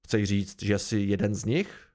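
low, disbelieving question; incredulous. A narrator's reading. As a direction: ask in understated, wary disbelief. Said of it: "ozval se starosta nevěřícně".

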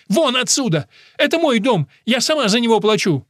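high, loud, indignant outburst; outraged woman defending her home; furious cry. A narrator's reading. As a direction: throw him out in a fury. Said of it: "выкрикнула мама".

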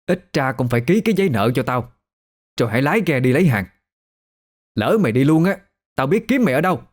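quick, rising angry demand; indignant creditor's tirade; angry older woman. A narrator's reading. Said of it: "giọng rung lên vì tức".

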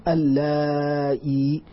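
اللائي said slowly with a clear hamza under kasra; slow teaching demo of اللائي with broken hamza; hamza reading. Shown as a demonstration. Teacher shows students the hamza reading, slow and clear. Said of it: "بهمزة مكسورة".

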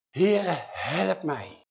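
slow, drawn-out plea; smekende hulpkreet. Acted. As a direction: beg tearfully, dragging each word. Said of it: "Ze knielt voor hem neer en ze zegt". (langzaam, huilerig smekend)

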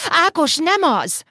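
high, drawn-out protest; snapped retort. A narrator's reading. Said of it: "vágta rá Cili".

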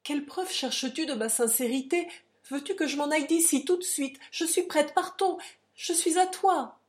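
high, loud impassioned offer; fervent, urgent surrender; sublime impulse. A narrator's reading. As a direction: build to an ardent, high-pitched pledge. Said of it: "elle eut un élan sublime".

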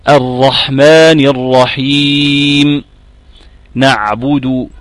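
very slow tajweed recitation of "الرحمن الرحيم نعبد" with the حاء and عين clearly from mid-throat; Quran recitation demo. Shown as a demonstration. Teacher reciting an example for students, slow and measured. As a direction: recite slowly, letting the throat letters ring. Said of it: "كان تقول إيه مثلًا".

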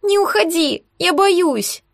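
put-on high-pitched child's plea; frightened squeal. A narrator's reading. Scared child begs Sasha to stay, high frightened plea. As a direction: plead in a small scared voice. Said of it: "испуганно взвизгнула она".

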